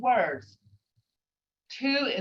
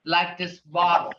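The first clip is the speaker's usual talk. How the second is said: normal talking voice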